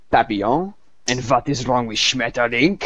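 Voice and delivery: indignant mock German accent, rising questions; hammed-up offended German punchline; fuming German. German guy defending his language in a joke, mock fuming German accent. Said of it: "the German sits there for a second fuming".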